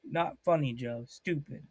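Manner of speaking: ordinary talk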